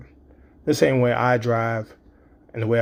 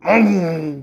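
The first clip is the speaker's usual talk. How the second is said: imitated boom; 6 semitones higher, 5 dB louder than usual. Daughter speeding, car noise.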